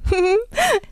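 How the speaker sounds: a high, light burst of laughter; girl's high laugh; bright girlish laugh. A narrator's reading. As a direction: laugh at him, amused. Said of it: "Das Mädchen lachte".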